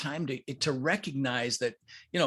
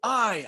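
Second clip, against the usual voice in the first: "I" pushed up high and held; stress on I; 7 semitones higher than usual. Shown as a demonstration. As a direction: hit "I" hard as an affirmation. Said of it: "I emphasize different words".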